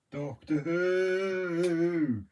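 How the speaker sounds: very slow, stretched Doctor Who; slow dramatic Doctor Who; drawn-out. Shown as a demonstration. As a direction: draw out Doctor Who like a theme.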